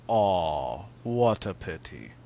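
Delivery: imitated German accent, low and slow; broken German accent. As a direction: lightly mimic a German father-in-law's accent. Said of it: "he says in his broken German accent".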